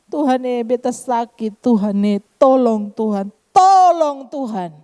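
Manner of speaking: hammed-up whining plea, last cry drawn out; mock complaining. Believer complaining to God of being sick, mock whining plea. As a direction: imitate someone moaning to God, building to a long wail. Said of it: "kita mengeluh".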